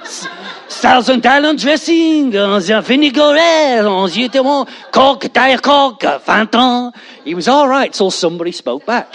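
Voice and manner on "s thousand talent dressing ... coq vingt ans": mock French accent, fast and high; rapid French. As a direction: babble fast fake French at the customer. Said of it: "until the person said".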